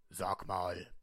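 low whispered voice; hushed low character voice; disguised deep voice. Acted. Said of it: "meine Stimme zu verstellen".

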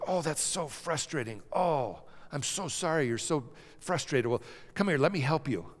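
soothing parent. Retold or imitated. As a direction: comfort a frustrated toddler kindly. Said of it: "mom and dad that goes".

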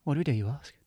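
cheesy ad read, loud and upbeat; advert pitch. Acted. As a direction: sell it like a late-night advert. Spiritual healers' advert pitch.

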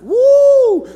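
high, loud celebratory woo; excited whoop. Retold or imitated. Preacher acting out joy at a raise, a loud high whoop. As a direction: whoop like you just got a raise.

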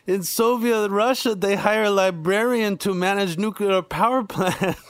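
high, laughing mock Russian accent; hammy Russian comic's punchline voice, laughing; stand-up comic's Russian accent joke, high and amused; Yakov Smirnoff impression. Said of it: "Yakov Smirnoff, the stand-up comedian".

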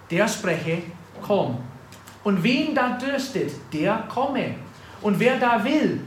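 laut und feierlich gepredigt; preaching scripture. Acted. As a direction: call out each phrase like an invitation. Preacher reads scripture aloud.

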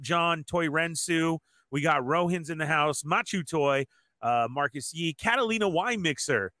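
ordinary delivery

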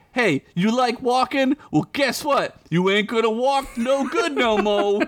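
high taunting street voice; gloating thug. Acted. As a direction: rub it in like a thug. Dogs' message voiced.

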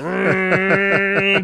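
high, held vocal car noise; imitated engine roar. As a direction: make a revving car sound by mouth. Imitating car in action scene.

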